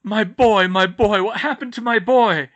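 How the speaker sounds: loud, high anguish over his son; a father crying for his boy; distraught cry. Acted.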